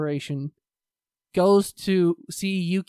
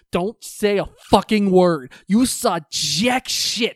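loud, slowed-down retold threat; mock angry warning, drawn out; threatening; 2 semitones higher, 7 dB louder, slower than usual. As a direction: lean hard on each word, menacing. Retold threat, pinning him to wall.

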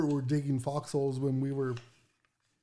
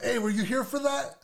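quoted question; 8 semitones higher than usual. Retold or imitated. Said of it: "he's like".

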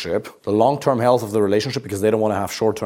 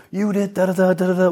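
higher, mocking rant of a partner; mock emotional girlfriend; 7 semitones higher than usual. Man mocking his upset partner, higher, hammed-up rant. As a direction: caricature her dramatic outburst, pitched up. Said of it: "she unleashes her femininity".

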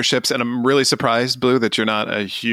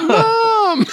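yelled mom call; 4 dB louder than usual. Retold or imitated. Imitating kid calling mom.

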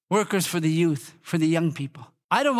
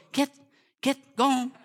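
mild, higher-pitched shooing; retold woman's first half-hearted command; shooing a dog; 8 semitones higher than usual. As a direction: shoo away a pesky little dog. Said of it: "and she goes".

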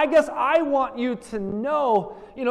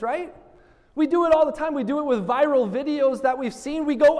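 ordinary talk

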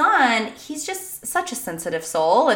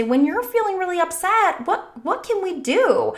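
higher, lightly voiced coaxing question; parent asking child; 8 semitones higher than usual. Parent asking upset child for ideas, gently coaxing. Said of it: "to ask them, say".

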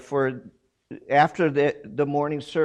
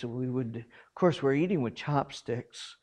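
normal talking voice

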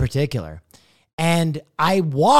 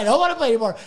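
raised-pitch mock protest; insistent refusal; 6 semitones higher, much faster than usual. Newcomer insisting he's quitting the game, petulant protest. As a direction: repeat it as a less shrieky, petulant refusal. Retold rage quit, second take.

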